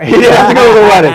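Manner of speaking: loud, quick, high laughing repeat; words tumbling out through laughter. Real, not acted. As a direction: repeat the joke while cracking up. Cracks up at listener's joke.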